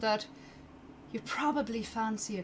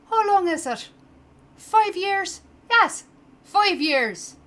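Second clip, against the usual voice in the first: exasperated barber; 5 semitones higher, 9 dB louder than usual. Acted. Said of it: "You see the guy kinda like".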